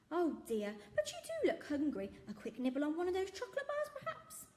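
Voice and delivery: high, coaxing shopkeeper voice; jolly shopkeeper. Acted. Shopkeeper coaxing the hungry girl, high and cheerful. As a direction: tempt her kindly with a treat. Raj offers chocolate.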